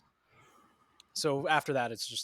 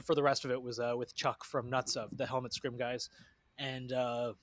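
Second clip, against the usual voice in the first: ordinary talk, nothing marked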